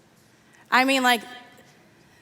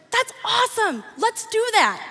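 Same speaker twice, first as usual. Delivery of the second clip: much higher, bright and eager; eager king; 9 semitones higher than usual. Acted. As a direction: jump at the idea like a delighted king. King eagerly approves Haman's idea.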